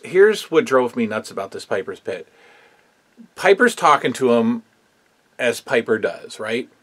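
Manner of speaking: normal talking voice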